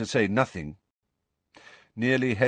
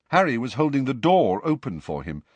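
usual speaking voice

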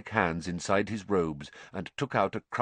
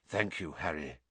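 low, soft gratitude; subdued, lowered voice; quiet thanks; 5 semitones lower, 8 dB quieter than usual. A narrator's reading. Freed Lupin thanks Harry.

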